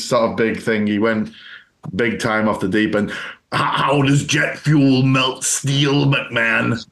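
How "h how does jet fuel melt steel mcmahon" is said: very slow, drawn-out ex-wrestler voice; Jesse Ventura. Acted. Host as ex-governor challenging McMahon, slow impersonation. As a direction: imitate a conspiracy-minded old wrestler, word by word. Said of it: "Ventura is his own kettle of fish".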